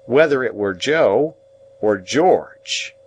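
mock-thoughtful afterthought; reflective. A narrator's reading. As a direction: weigh one name against the other. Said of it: "with an appearance of reflection".

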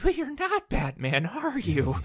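sing-song menace, toying with him; Joker impression. Podcaster imitates the Joker taunting a Batman poser, high mocking lilt. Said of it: "he's like".

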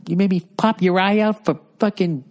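mimicking a movie tough guy mid-threat; Casino movie quote. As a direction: quote the gangster film in a put-on voice. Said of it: "I'm thinking about casino".

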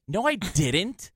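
retold defensive denial; insistent denial. Acting out his denial to friend.

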